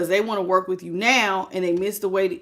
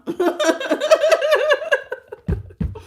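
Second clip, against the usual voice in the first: high burst of laughter; 3 dB louder than usual. Real, not acted. Laughs after joking quote.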